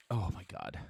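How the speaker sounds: ordinary talk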